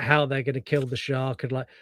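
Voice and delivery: usual speaking voice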